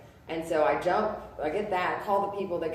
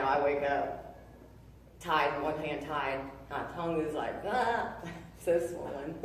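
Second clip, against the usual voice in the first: ordinary talk, nothing marked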